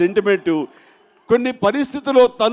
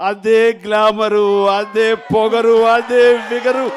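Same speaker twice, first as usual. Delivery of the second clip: rising louder praise, slow; each phrase louder and higher; building to a shout; 3 semitones higher, 7 dB louder, slower than usual. Real, not acted.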